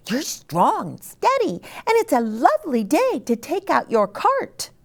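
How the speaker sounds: very high, clucky hen voice. Acted. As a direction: squeak it out like a busy little hen.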